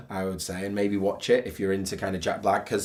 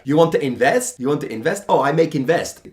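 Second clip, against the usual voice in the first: mock broken English with a Polish accent; Polish accent imitation; 4 semitones higher, 7 dB louder than usual. Reviewer mocking Jack's Polish accent, loudly. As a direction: parody Jack's Polish accent, pitch rising on invest. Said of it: "he's like".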